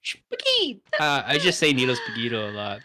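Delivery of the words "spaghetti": high-pitched comic outburst; cartoon supervillain catchphrase; silly villain cry. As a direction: squeal it like a mock battle cry.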